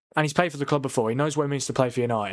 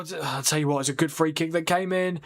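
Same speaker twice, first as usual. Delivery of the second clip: ordinary talk, nothing marked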